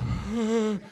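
mock breathy gasp greeting. Roommate greeting in a mock breathy gasp. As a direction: gasp in like a hello. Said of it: "that's how he'd say hello".

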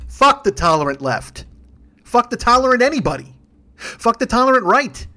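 spaced-out, hammering rage; angry outburst. Real, not acted. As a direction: punch out each phrase furiously. Angry rant, banging the desk.

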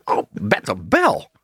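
name it slowly like a talking parrot; put-on bird voice, low and drawn out; Apollo. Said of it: "'Cause he says it".

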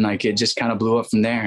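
ordinary talk, nothing marked

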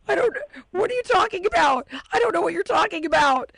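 high-pitched mock bewilderment; acted flustered denial. Giancarlo answering the accusing captain, acted high-pitched protest.

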